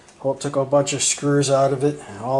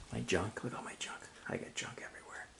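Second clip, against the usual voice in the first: whispering; 3 semitones lower, 20 dB quieter, faster than usual. Real, not acted. Muttering about junk in garage.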